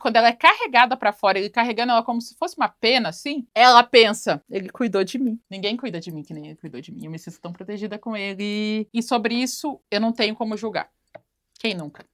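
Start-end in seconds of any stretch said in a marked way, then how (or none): 4.5-8.9 soft, lower, dreamy confession; swooning. Retold or imitated. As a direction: melt a little as she says it. Rachel apaixonada pelo segurança. (Rachel pensa no segurança, voz baixa e encantada)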